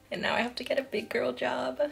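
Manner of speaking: hushed, playful whisper aside. Real, not acted. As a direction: whisper it like a little secret. Whispered aside about leaving job.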